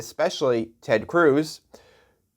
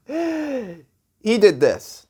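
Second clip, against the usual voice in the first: slow, drawn-out; 7 semitones higher, much slower than usual. Acted. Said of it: "comic pause".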